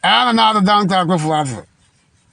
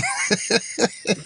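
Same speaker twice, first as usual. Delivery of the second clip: soft, breathy chuckle at his promises; mocking laugh; 2 semitones higher, 7 dB quieter than usual. Real, not acted. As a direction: laugh quietly in derision.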